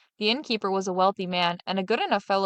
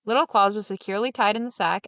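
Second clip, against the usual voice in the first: plain reading voice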